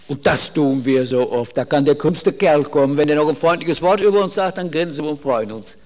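ordinary talk

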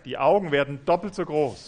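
loud, agitated narration. Shown as a demonstration. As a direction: say it as tempers rise. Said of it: "werden laut". (laut und aufgebracht)

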